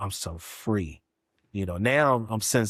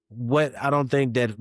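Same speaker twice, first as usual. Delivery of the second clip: usual speaking voice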